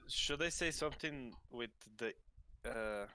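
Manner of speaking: ordinary delivery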